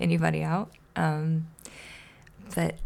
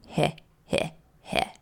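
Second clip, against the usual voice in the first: breathy wicked heh heh; unvoiced teasing snicker; sly snicker; 3 semitones lower than usual. Oscar snickers menacingly.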